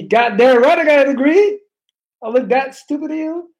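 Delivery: mock outrage. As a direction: fake offence, puffed-up and loud. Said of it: "I'm joking, by the way".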